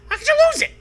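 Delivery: very high, loud shrieking coach; coach roars. Acted. Coach Curley yelling at the player, shrieking roar. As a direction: screech it like a furious coach. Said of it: "Coach roars".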